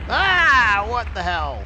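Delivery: sudden high, loud what the hell; shocked yelp at the screen; startled outburst. Real, not acted. Train crashes unexpectedly.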